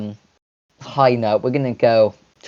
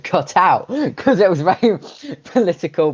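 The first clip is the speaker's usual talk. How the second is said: laughing; 7 semitones higher than usual. Real, not acted. Laughing over a political joke.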